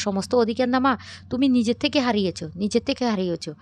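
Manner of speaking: acted forceful scolding; heated rebuke. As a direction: raise your voice to reproach her. Daughter rebukes mother in quarrel.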